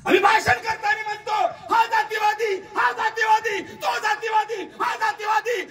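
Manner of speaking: high, loud scolding sermon; rebuking his own people; preaching at full force. Acted. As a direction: rail at the crowd from the stage.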